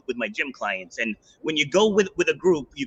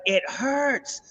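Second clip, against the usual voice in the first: mock pained cry; 11 semitones higher than usual. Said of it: "She's like".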